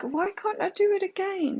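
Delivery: put-on high, whining child asking why; a hint of a toddler's complaint; whiny toddler. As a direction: mimic a toddler who is frustrated. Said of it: "This, this is like".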